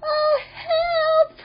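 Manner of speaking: very high cry for help; shout. A narrator's reading. Chip in the crashing car, high shout for help. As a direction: squeal it out, long and high. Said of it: "shouted Chip".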